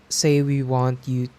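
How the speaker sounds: usual speaking voice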